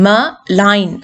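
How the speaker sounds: "ma, line" as two parts with a gap; split into syllables. Shown as a demonstration. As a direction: break malign into "ma", pause, "line". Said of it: "disyllabic word ആണ് malign". ("ma, line" ഇടവേളയിട്ട് രണ്ട് അക്ഷരങ്ങളായി)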